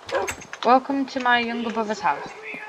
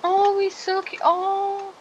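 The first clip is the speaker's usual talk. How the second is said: high, slow cooing over a cute animal; high cooing at a cute pet; 11 semitones higher, much slower than usual. Real, not acted. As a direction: gush over something adorable, high and slow.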